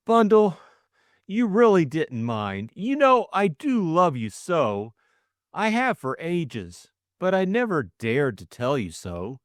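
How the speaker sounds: high, eager confession, gaining courage; nervous suitor's rush of feeling; earnest young man. Acted. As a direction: blurt out love he has hidden for ages. Bill confesses his love.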